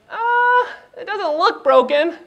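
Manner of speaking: very high-pitched imitation of wife; mock a doubtful wife in falsetto; dismissive, squeaky put-on reply; unconvinced wife. Wife's retold sceptical reply.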